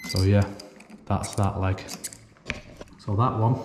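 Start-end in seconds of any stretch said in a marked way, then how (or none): none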